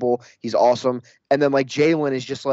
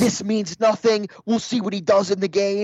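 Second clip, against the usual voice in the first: dismissive commenter; 6 semitones higher than usual. Retold or imitated. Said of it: "half the comments were like".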